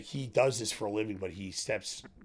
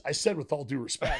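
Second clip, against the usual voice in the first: laughing retelling; 6 semitones higher, 7 dB louder than usual. Retold or imitated. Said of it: "He goes".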